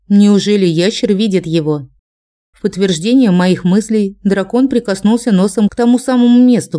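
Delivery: usual speaking voice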